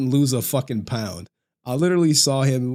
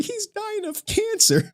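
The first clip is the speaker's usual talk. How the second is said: very high, slow mocking whine; slow high sarcastic whine about cancer; slower than usual. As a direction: imitate the chatter as a pathetic whiner. Mocking losers in his chat.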